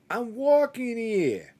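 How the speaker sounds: high, slow New York "I'm walking here"; mock New York yell. Coworker mocking him with a New York accent. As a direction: drawl it like a cabbie in New York. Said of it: "try to make fun of me the other day by yelling out".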